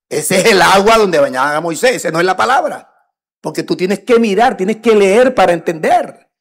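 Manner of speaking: preacher thundering the scripture to his flock; full-force preaching. Acted. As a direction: proclaim the verse to the congregation.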